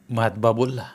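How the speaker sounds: ordinary delivery, nothing marked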